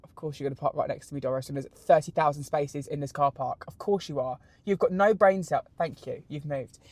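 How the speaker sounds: softly grumbling under her breath at another driver; muttering to herself. Real, not acted. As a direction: mutter it, annoyed, half to yourself. Muttering at driver Doris while parking.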